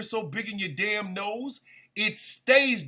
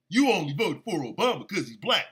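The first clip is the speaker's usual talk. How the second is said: mocking voice; 6 semitones lower, faster than usual. Mocking an accuser's claim.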